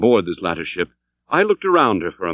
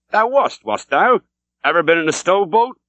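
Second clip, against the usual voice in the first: put-on a gruff old sea captain, pitched higher, pressing the question; demanding old captain; 8 semitones higher than usual. A narrator's reading. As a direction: challenge the stranger like a suspicious old skipper. Captain Peleg questions Ishmael.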